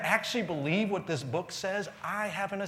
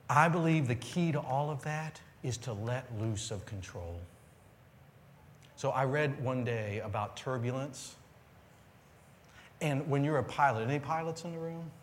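usual speaking voice

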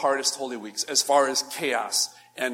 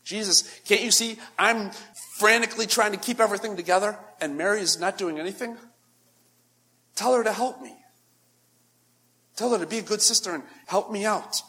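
higher, pleading, frazzled sister; a frazzled hostess complaining to her guest; frantic Martha; 5 semitones higher than usual. Retold or imitated. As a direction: nag Jesus to make her sister help. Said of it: "she tries to wring Jesus into the equation".

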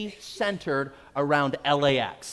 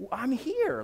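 pitched up, insistent and fed up; exasperated; 8 semitones higher than usual. Retold or imitated. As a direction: say it like you've said it ten times. Insisting again, getting exasperated.

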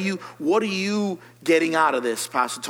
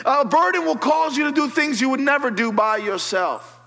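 higher, louder, driving sermon build; preaching at full force; 9 semitones higher, 4 dB louder than usual. Acted. Pastor urges the congregation to get bothered, preaching at full force. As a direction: preach it hard to the altar call. Preaching climax, high and loud.

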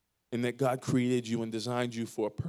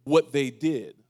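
louder, slow, emphatic preaching; forceful preaching; 3 semitones higher, 6 dB louder, slower than usual. Acted. Pastor hammering home forgiveness to the church, loud and slow. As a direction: drive each word home to the congregation.

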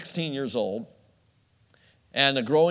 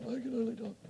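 high, much softer choked voice; mock strangled voice, much higher and softer; 10 semitones higher, 9 dB quieter than usual. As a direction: squeeze it out like a lump in the throat. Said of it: "I'm like".

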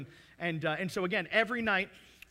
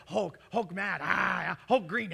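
lightly growled Hulk imitation; 4 dB louder, slower than usual. Dad hyping up the Hulk movie for his daughter, mock growl. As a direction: play Hulk for a toddler.

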